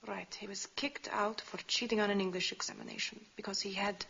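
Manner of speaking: ordinary talk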